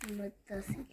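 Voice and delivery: soft unvoiced whisper. Real, not acted. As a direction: breathe it out, no voice. Child whispers during homework. (همس)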